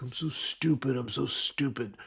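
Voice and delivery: imitated self-reproach, slowing on the repeat; self-flagellating. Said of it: "We always, like, self-flagellate".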